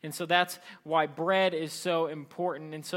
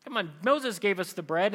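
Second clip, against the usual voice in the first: higher-pitched indignant challenge; grumbling; 5 semitones higher, 4 dB louder than usual. Acted. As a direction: grumble in disbelief, voice raised in pitch.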